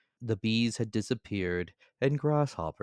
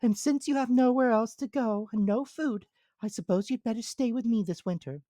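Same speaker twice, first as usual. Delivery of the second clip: high, louder busy little ant; high squeaky ant character; 5 dB louder than usual. Acted. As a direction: voice the ant as small and sensible.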